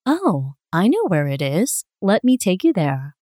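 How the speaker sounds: usual speaking voice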